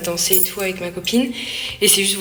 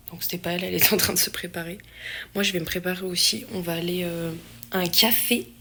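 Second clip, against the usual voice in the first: ordinary delivery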